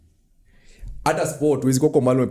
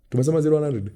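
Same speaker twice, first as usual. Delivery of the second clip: ordinary talk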